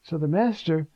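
ordinary delivery

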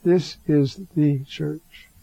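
usual speaking voice

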